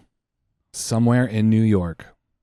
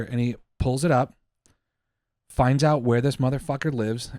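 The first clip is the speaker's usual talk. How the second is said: normal talking voice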